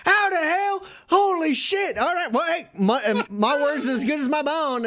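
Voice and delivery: marvel, then give in; amazed bartender. Acted. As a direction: bartender seeing a second bullseye. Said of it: "he's like".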